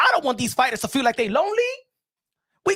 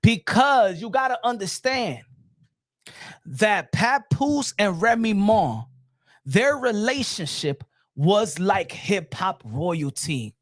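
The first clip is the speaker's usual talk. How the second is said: low, drawn-out, weighty phrasing; slow, solemn explanation; 6 semitones lower, slower than usual. Real, not acted. Host explains couple's standing to boxer, slow and solemn. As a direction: spell it out slowly, with long pauses.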